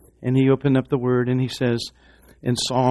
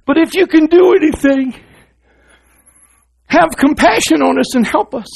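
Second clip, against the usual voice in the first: a desperate father's plea, higher and louder; exasperated father; 13 semitones higher, 6 dB louder than usual. Retold or imitated. Father pleading with Jesus for his son, exasperated. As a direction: beg for help at the end of his rope. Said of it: "here's this exasperated father says".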